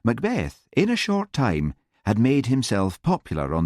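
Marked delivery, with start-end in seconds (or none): none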